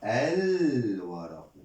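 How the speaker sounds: slow الورق with a long L: alll-waraqu; lam held long. Shown as a demonstration. Teacher confirming the moon letter in الورق, L drawn out. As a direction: stretch the L in الورق.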